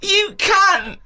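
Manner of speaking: high, sobbing furious shouting; screeching, tearful rage at a cheater; swearing tirade. Pretend. Mock row in the park.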